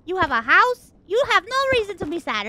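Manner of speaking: loud, high mock lecture; imitating lecturing mom. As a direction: rattle off reasons like an exasperated mom, pushing each item. Mom listing reasons, voiced.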